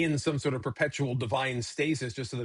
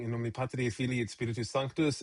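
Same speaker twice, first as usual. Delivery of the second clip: softer, solemn liturgical Latin; mock Latin prayer; 2 semitones lower, 4 dB quieter than usual. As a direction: intone it like a priest closing prayer. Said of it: "ending one of his prayers just going".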